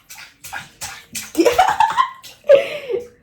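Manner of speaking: delighted high-pitched laugh; high squeal of laughter. Real, not acted. Laughing at baby in bath.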